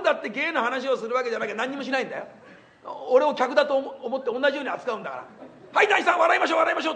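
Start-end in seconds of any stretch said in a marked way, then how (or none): 5.7-6.9 higher, louder, pushy cheer; bright comic patter pushing a guest to laugh; imitating Sanpei. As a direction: mimic the comic's forced jolly coaxing. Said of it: "って言うんだからね".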